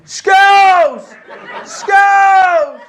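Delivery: very high, loud mock scream; screaming Skittles. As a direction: howl the word like the ward patient. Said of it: "he would just scream".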